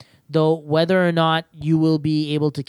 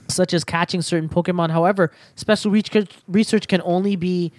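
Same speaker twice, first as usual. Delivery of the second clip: usual speaking voice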